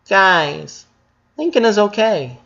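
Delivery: high voiced sister reassuring; airy girl voice. A narrator's reading.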